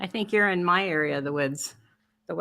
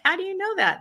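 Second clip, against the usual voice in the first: much higher, mock-baffled question; re-enacted puzzled reply; 11 semitones higher, faster than usual. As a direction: act out your own puzzled comeback. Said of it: "I'm like".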